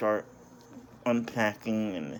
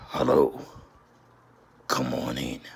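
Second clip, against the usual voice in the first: creepy figure impression; 9 semitones lower than usual. Figure with glowing red eyes calls Andy, creepy deep voice. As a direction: growl a sinister invitation far below your own pitch. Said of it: "trying to do my best impression".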